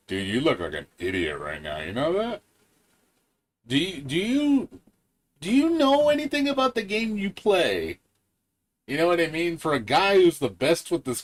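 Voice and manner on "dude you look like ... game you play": incredulous scolding. Retold or imitated. Mocking the top player to his face, climbing to outrage. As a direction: build from scorn to outraged disbelief. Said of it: "I was like".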